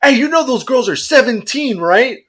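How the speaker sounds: lightly voiced dad issuing a warning; confronting dad. Dad stepping in about his girls, confronting. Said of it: "the dad goes".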